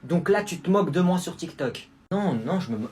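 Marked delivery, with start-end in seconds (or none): none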